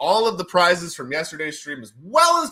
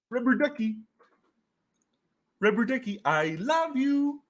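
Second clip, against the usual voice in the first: Ernie impression; 7 semitones higher than usual. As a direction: croon to the rubber ducky like Ernie. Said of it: "Isn't that how Ernie talks?".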